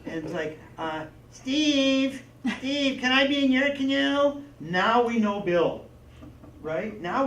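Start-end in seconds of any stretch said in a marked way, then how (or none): 1.3-4.4 put-on high, loud whining kid; whiny kid voice. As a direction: whine like a clingy little boy. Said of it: "he had that whiny voice".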